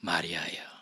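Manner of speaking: soft, breathy whisper of a beloved name; tender, loving call. Acted. Jesus gently calling Mary by name, a tender whisper. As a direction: call her name softly, like you have many times. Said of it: "사랑하는 마리아를 불렀던 그 톤으로".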